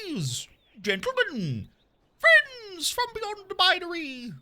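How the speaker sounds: slow, grandiose showman welcome; grand announcer intro. Acted. Streamer opening the show to chat, slow grand announcer voice. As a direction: announce it like a ringmaster.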